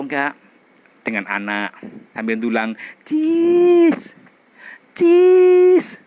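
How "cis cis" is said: high, loud fake "cis"; a stiff posed "Cis, cis" for the camera; forced cheese. Shown as a demonstration. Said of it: "Belum manis, tengok di cermin". ("cis" tinggi dan keras, dipaksakan)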